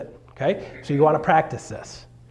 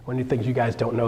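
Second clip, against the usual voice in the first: ordinary talk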